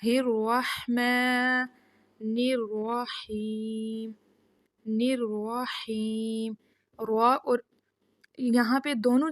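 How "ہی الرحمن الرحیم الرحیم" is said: الرحیم with a thick ر and throaty ح; slow heavy ra. Shown as a demonstration. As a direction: stretch الرحیم very slowly. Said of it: "وسط حلق سے ادا ہوتا ہے". (موٹی را اور حلقی حا)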